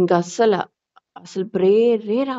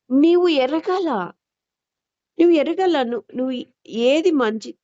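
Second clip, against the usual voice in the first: raised, slow urgent exhortation; fervent preaching; 5 semitones higher than usual. Acted. Preacher urging the congregation to discern good, fervent slow exhortation. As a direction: press each word home like a warning.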